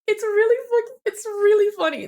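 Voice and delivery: high and loud, half crying with laughter; can barely get the words out for laughing; laughing outburst. Real, not acted. Reading a fan's tweet and cracking up, loud and high.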